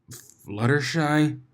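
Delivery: slow, strained croak; choked out. Acted. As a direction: force the name out groggily. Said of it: "Blitz choked out".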